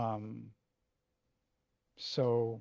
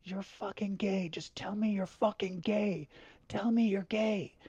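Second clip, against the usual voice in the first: dad yelling; 8 semitones higher than usual. Retold or imitated. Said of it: "he was like".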